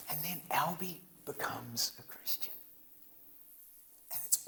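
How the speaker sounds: soft whispered aside. Real, not acted. Preacher to congregation, whispered aside. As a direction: confide it under your breath.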